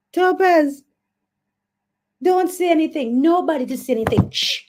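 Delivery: high, urgent plea for silence; mock-panicked hushing. Host begs viewers to keep her slip secret, mock panic. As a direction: beg them to cover for you.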